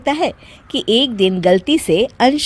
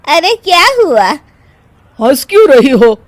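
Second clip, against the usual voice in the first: loud, raised puzzled questions; taken aback; 7 semitones higher, 8 dB louder than usual. Acted. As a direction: demand to know what's funny. Startled by sudden laughter.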